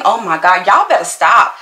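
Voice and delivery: ordinary talk, nothing marked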